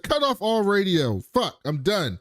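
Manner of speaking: retold mad. Mimicking Bernard cutting off all radio, mad. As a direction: imitate a boss angrily giving up. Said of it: "he was so mad".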